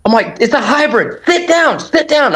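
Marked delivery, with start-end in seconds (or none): 0.4-1.2 high, urgent retold yell; mock yell with little extra volume; yelling at him. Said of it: "I'm yelling at him". 1.2-2.3 high, repeated urgent order; retold yell, pitched way up; yelling at him. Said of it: "I'm yelling at him".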